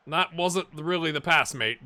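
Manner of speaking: ordinary talk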